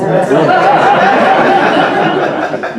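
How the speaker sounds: loud laugh; big laugh. Real, not acted. Audience laugh.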